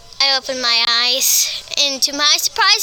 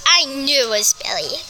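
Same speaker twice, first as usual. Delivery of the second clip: ordinary delivery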